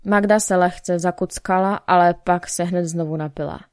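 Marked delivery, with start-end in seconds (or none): none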